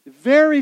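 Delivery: "Very" burst out high and loud; emphatic very. Real, not acted. Preacher stressing to the congregation how strong, loud. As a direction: punch "Very" hard and high. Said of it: "very strong".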